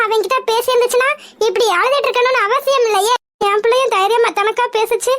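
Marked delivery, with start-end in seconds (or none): none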